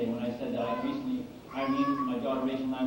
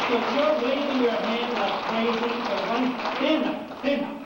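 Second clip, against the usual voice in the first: high, loud call across the audience; loud, raised-pitch urging to stand; 9 semitones higher, 5 dB louder than usual. Real, not acted. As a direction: call to someone waving in the crowd. Calling out to an audience member.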